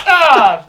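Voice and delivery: loud pained groan; pun groan. Real, not acted. Groans after failing the joke.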